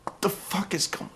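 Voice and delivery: whispered, stunned disbelief; baffled whisper. Retold or imitated. Watching security attack the wrestlers, a stunned whisper. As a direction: breathe it out in disbelief.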